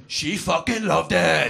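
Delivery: slow, smug boast; cocky young lad. Retold or imitated. Young lad to his mates, a slow smug boast. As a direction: savour every word of the brag. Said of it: "stories ended with".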